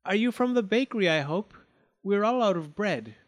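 high, quick call. A narrator's reading. Girl at the window calls to the rower, high. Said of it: "The beautiful face called".

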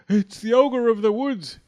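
high, loud shout of alarm. A narrator's reading. Fleming warns of the ogre, shouting high. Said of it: "shouted Fleming".

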